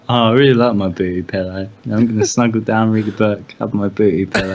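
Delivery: low-pitched, contented bloke; bloke loving his pillow. Acted. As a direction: play a cosy fan of his pillow, voice dropped low. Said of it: "And he's like".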